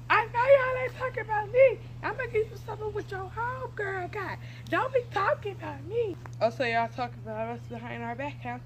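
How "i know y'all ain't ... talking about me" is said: put-on higher, indignant girl confronting the gossip. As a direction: call them out for talking behind your back.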